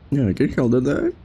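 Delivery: normal talking voice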